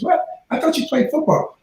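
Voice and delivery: surprised friend. Retold or imitated. Voicing female friend, surprised.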